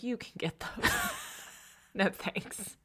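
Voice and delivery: hushed refusal. Retold or imitated. Refusing to pick up tissues.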